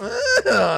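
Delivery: very high drawn-out cackle; hammed-up gloating laugh; mock villain cackle. Streamer pretending greed over donations, high mock cackle.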